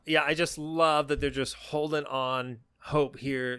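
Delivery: ordinary delivery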